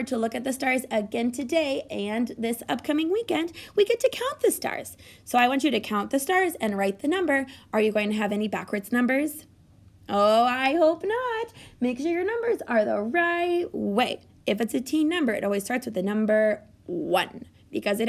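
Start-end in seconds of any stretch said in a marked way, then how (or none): 3.8-5.0 excited. Real, not acted. Teacher announces stars excitedly. 10.1-14.2 playful. Acted. Teacher teases about backwards numbers.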